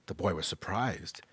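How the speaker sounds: ordinary delivery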